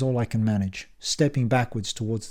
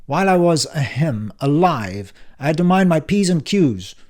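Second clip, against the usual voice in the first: put-on louder, higher, impatient old man; brusque uncle Frank; 5 semitones higher, 6 dB louder than usual. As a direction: bark it like a testy uncle back from the dead. Frank lecturing.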